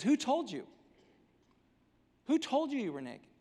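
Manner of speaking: God angrily confronting Adam about hiding; angry God. Acted. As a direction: thunder the question at the hiding man. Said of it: "God gets angry".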